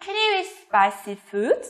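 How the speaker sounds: slow question, "food" swooping up; rising intonation. Shown as a demonstration. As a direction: raise "food" at the end. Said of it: "lên giọng ở cuối câu".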